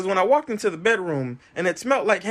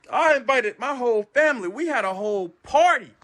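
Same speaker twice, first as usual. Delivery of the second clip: usual speaking voice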